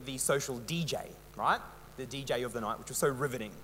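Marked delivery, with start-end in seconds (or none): 2.0-3.5 sarcastic. Real, not acted. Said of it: "am I getting that message across too strongly".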